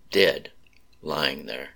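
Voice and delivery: ordinary delivery, nothing marked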